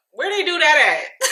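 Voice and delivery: high, loud disbelieving question; incredulous reaction. Retold or imitated. Vlogger reacting to the star's slick comforting, incredulous. Acted disbelief at retold behaviour.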